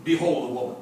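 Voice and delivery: normal talking voice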